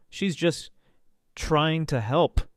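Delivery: small, brittle, hesitant voice; weak mumble. A narrator's reading. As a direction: plead weakly, breaking after the first words. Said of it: "he mumbled".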